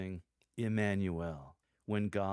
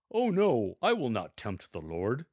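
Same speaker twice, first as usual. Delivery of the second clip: false piety; 3 semitones higher, 6 dB louder than usual. Acted. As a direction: ham up a sanctimonious refusal. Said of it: "a tone of voice that captures his false piety".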